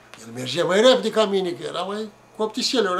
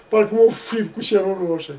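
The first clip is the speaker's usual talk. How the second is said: ordinary talk, nothing marked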